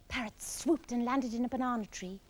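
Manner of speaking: ordinary delivery, nothing marked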